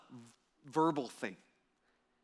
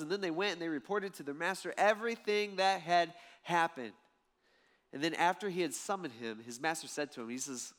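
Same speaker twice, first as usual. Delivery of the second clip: ordinary delivery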